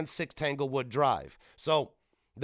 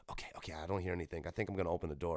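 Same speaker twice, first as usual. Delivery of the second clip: low, soft, quick self-talk; hushed caution; 7 semitones lower, 10 dB quieter, much faster than usual. Retold or imitated. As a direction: cautiously reassure yourself under your breath. Retold cautious exit from hiding.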